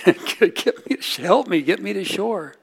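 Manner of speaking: laughing retelling. Retold or imitated. Retelling her plea, laughing.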